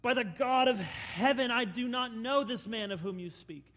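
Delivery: loud emphatic oath; swearing oath. Acted. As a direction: swear by heaven with force. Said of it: "And then he swears".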